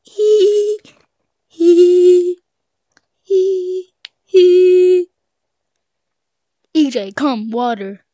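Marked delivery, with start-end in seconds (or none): 0.0-5.1 high taunting giggle. Real, not acted. Taunting giggle while tagging.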